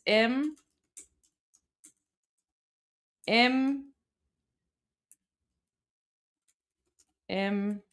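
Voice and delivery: "Im", then "Imrika" sounded out piece by piece; pronunciation demo. Shown as a demonstration. Streamer showing viewers how it's pronounced, slow sounded-out "Imrika". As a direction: break "Imrika" into separate sounds with long pauses. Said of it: "so we pronounce it like this".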